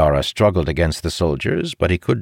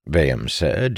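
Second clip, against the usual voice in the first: ordinary delivery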